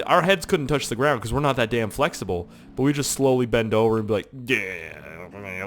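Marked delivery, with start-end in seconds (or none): 4.5-5.6 low drooping groan, like a machine dying; drained, sinking drone; powering down. Retold or imitated. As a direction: let it sag down as if losing power. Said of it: "like we're losing power".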